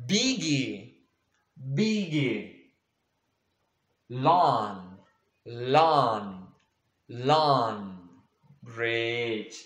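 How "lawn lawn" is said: ordinary talk, nothing marked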